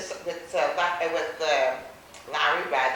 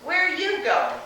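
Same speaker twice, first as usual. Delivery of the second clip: loud, higher challenge; sharp loud question; 9 semitones higher, 9 dB louder than usual. Retold or imitated. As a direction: stop her with a loud demand. Man stops girl entering car.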